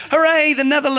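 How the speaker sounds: high, loud cheering; mock cheer. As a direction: imitate a crowd cheering. Imitating cheering Dutch audience.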